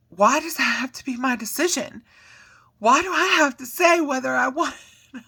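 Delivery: put-on high, loud screaming child; imitating a screaming kid. Mom imitating her daughter screaming at her, high and loud.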